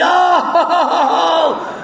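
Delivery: high loud "oh oh" laugh; imitated laughter. Sponsor hooting with laughter, high and loud. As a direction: hoot it out high.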